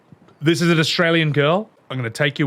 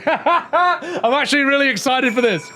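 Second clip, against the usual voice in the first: high, giggling repeat of the line; laughing excitedly; 10 semitones higher than usual. Real, not acted. Streamer repeating his line, laughing high. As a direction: squeal it through a laugh. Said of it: "repeated with laugh".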